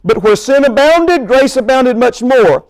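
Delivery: scripture reading. Acted. Preacher reading scripture to the congregation, emphatic. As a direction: read the verse out with driving emphasis.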